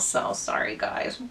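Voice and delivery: soft, near-whispered, choked-up sorry; apologising to her viewers after almost crying; tearful apology. Real, not acted. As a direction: barely get the words out through tears. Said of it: "I got a little emotional".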